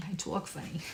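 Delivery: imitating Long Island friends, softly amused; soft mock Long Island "talk"; Long Island accent. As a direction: tease in a Long Island accent, half laughing. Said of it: "tell me I talk funny".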